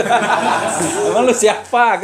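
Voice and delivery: mocking sneer. Indonesian local brushing off a friendly greeter, slow laughing sneer. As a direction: drag it out like a rude put-down, laughing. Retelling rude reply to greeting.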